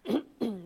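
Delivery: clears throat once; throat clearing. Real, not acted. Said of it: "I've got a tickle in my throat".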